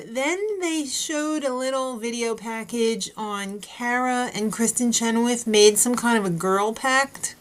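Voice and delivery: ordinary delivery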